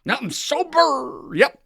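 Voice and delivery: high, chirpy fake-cheerful sponsee voice; high mock-cheerful voice of a sponsee faking sobriety. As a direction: parrot the too-perfect newcomer in a high, bright sing-song. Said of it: "And he goes".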